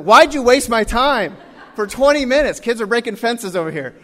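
acted-out indignant outburst; exasperated complaint. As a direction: demand an answer in disbelief. Retold exasperation at wasted time.

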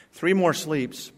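normal talking voice

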